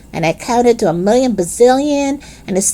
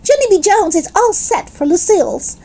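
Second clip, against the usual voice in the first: joyful; 9 semitones higher than usual. Acted. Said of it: "I hollered, real joyful".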